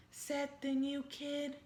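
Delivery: ordinary delivery, nothing marked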